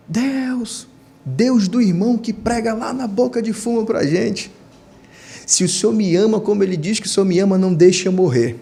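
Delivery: high-pitched mock shouted plea to God; retold loud cry. Overdosing man alone in his room begging God, retold cry. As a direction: re-enact a desperate cry upward, voice pitched high. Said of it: "eu gritei bem alto".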